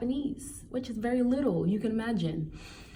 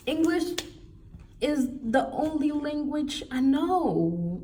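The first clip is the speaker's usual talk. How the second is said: incredulous; 8 semitones higher, slower than usual. Real, not acted. Indignant at native speaker rejection.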